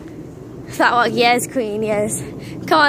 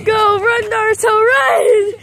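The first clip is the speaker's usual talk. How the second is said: shout; 7 semitones higher, 5 dB louder than usual. Real, not acted. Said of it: "That's nothing like Naruto".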